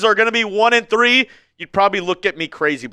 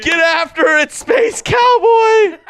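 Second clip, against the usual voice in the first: loud, high hype call; hype shout; 9 semitones higher, 4 dB louder, much slower than usual. Real, not acted. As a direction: cheer the donor's name out. Hyping a donor on stream.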